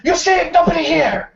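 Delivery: Dex yells that he keeps being bothered; Dex shouting. Acted. The innkeeper shouts at the guards, high and indignant.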